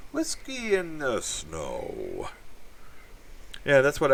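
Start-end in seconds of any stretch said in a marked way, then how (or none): 0.1-2.3 very slow, savoring each word; slow drawn-out name. Acted. Greeting a viewer by name, stretched very slowly.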